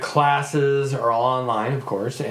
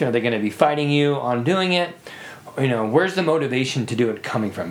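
usual speaking voice

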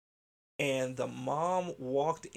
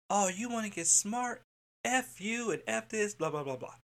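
mock angry mom; 7 semitones higher than usual. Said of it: "the mom was like".

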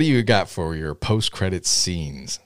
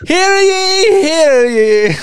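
much higher, louder mock royal proclamation; town crier; 9 dB louder, much slower than usual. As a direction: announce the court like a herald. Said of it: "They don't say that, [name]".

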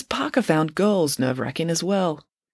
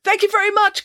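high, loud call after someone; 5 dB louder than usual. A narrator's reading. Parker calls after her as she jogs off, calling out. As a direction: call it out high-pitched and raised. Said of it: "called Parker".

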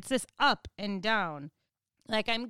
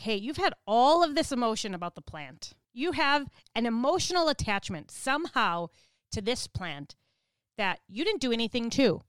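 normal talking voice

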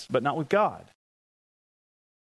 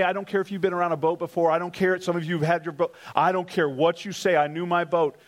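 usual speaking voice

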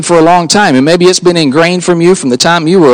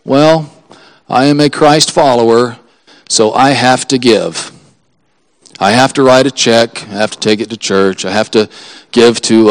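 reluctant, dutiful, sighing through it; like giving is a chore you resent; grudging obligation; 6 semitones lower than usual. Retold or imitated. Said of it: "the mental attitude of".

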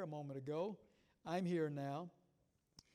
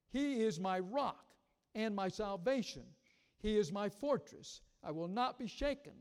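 loud, declaiming the psalm; preaching voice, full conviction; scripture read with force; 5 dB louder than usual. Acted. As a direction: proclaim it to the congregation.

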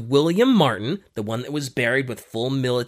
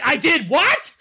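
high, louder astonished question; surprised; 12 semitones higher, 4 dB louder than usual. Acted. Glyndor hearing he killed Hitler, high and astonished. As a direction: blurt it in disbelief.

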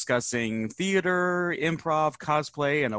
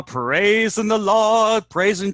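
mock preacher; 8 semitones higher, 6 dB louder, slower than usual.

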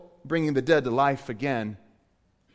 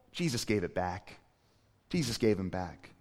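much softer and lower, gentle; hushed resolution; 10 semitones lower, 8 dB quieter than usual. Acted. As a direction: let the relief land quietly. Soft resolution after climax.

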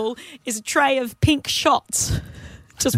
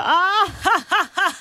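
higher, playful fake ha ha ha; mock triumphant laugh; 8 semitones higher, slower than usual. Co-host answering her partner's moment with a hammed-up ha ha. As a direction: act out a gleeful little aha laugh. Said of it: "I had a ... moment".

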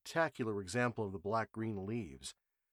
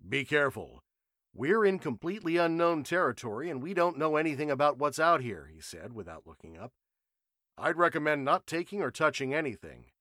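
louder, earnest warning; cautious scientist; 5 semitones higher, 7 dB louder than usual. A narrator's reading. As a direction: warn them without looking up. Matthews voiced, cautious.

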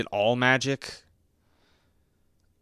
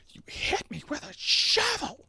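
much higher, mock-outraged squeal; shrieked quote. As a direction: fling it out like an indignant accusation. Using movie line as comeback.

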